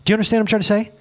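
usual speaking voice

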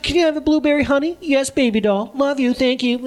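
high, sugary mock sweet talk between spouses; sweet couple talk. Wife and husband cooing at each other, mock sugary. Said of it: "She's like".

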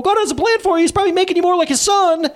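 very high, over-eager consoler; hammed-up preachy pep talk; mock pious encouragement. Mocking preachy consolation to injured kid.

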